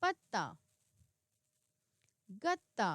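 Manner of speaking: पत्ता and गत्ता with a held doubled त; model word reading. Shown as a demonstration. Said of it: "मैंने आपको बताया था ना".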